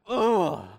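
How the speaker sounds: groan. Retold or imitated. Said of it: "I'm like".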